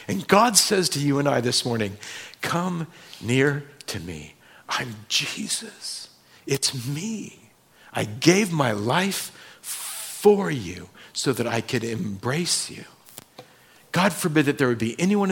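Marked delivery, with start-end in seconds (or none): 2.4-12.8 soft, slow, hushed invitation; God softly inviting the church close; tender whisper. Acted. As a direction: speak it gently as God calling near.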